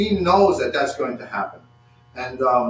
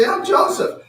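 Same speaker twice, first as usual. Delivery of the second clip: much higher, acted outcry; crying out; 3 dB louder than usual. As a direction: cry it out in a high strained voice. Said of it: "he just cries out".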